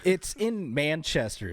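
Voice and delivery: ordinary talk, nothing marked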